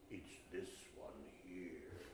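low, slow, hushed stranger; eerie stranger. Acted. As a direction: point it out slowly, deep and softly. Stranger points at the grave.

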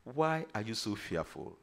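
soft, low question; gentle reproach. Acted. Jesus turning to his own after calming the wind, quiet reproach.

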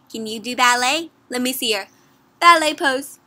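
ordinary delivery

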